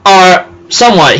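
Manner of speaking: ordinary talk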